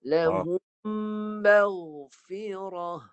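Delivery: "maghfirah" stretched very slowly with a thick ghain; teacher modelling the phrase; recitation demo. Shown as a demonstration. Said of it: "naikkan pangkal lidah bagi tebal".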